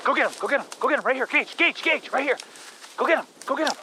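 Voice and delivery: quick high sing-song dog encouragement; urging the dog on. Real, not acted. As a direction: egg the dog on toward the bird. Urging dog toward the bird.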